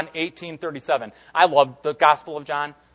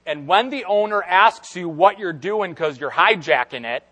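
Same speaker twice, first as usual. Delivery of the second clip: normal talking voice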